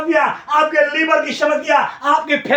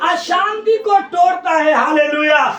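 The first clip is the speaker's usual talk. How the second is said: fiery preaching; 6 semitones higher than usual. Acted. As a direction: preach it with full force, lifting the crowd. Preacher at full force.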